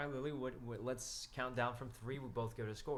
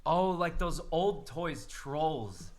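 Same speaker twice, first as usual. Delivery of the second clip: excited; 6 semitones higher, 9 dB louder, much slower than usual. Real, not acted. Excited recognition of the toys.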